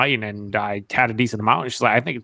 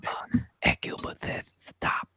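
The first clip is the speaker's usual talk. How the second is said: mock ASMR whisper; 6 dB quieter than usual. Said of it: "A S M R".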